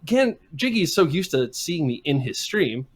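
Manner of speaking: ordinary delivery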